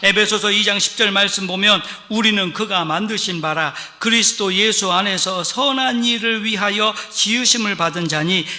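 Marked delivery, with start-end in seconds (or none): 2.1-8.5 solemn, measured Bible reading to the congregation; scripture reading. Acted. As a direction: read the verse out with weight, slowing at the end. Reads scripture aloud.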